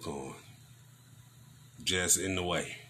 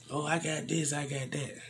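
mocking know-it-all; 6 semitones higher, 5 dB quieter than usual. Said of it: "all you people that think you got something".

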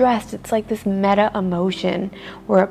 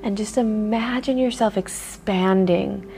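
guided meditation; 4 dB quieter than usual. Acted. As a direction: lull them into stillness. Leads a grounding meditation.

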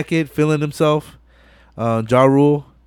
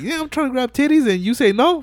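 much higher, whiny mock complaint; mock frustrated dude; 11 semitones higher than usual. Guy denied by the girl, high whiny complaint. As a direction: whine like a guy turned down.

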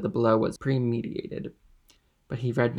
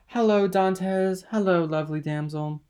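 put-on drunken calling out; 7 semitones higher, 4 dB louder than usual. A narrator's reading. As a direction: call out to the couple like a tipsy man. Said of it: "pretending to restrain Caderousse".

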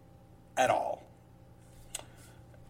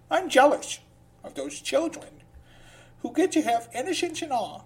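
high mock voice; 10 semitones higher than usual. Mock jealous aside in quote.

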